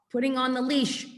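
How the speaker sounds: normal talking voice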